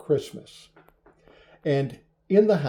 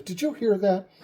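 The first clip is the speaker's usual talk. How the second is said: high, more forceful question; mock-urgent, insisting on an answer; insistent repeat; 7 semitones higher than usual. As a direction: press the question, pitched up high. Kenny insists on having heard it.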